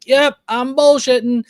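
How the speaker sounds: high, put-on troll voice owning up; mock the troll admitting the con; imitating a troll. Host imitating a troll who openly admits bullshitting.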